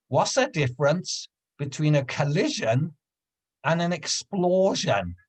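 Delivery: lightly voiced old lady setting up a joke; old woman on a bench poses a riddle, lightly imitated; old woman's riddle.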